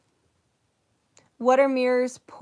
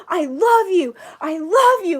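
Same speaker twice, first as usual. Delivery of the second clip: high, loud, heartfelt I love you; loud emotional affirmation; 7 semitones higher, 10 dB louder than usual. Retold or imitated. Whole room telling themselves they love them, loud heartfelt. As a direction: pour it out with full feeling.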